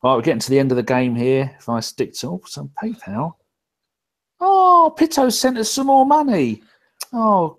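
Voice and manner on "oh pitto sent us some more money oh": high, loud delighted burst; thrilled at a surprise donation; excited. Real, not acted. Host reacting to a PayPal donation with high, loud delight.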